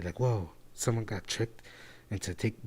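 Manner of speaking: normal talking voice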